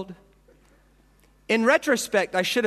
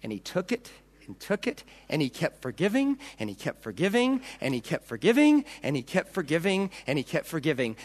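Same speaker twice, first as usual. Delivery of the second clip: hushed refrain; 4 semitones lower, 6 dB quieter than usual. Acted.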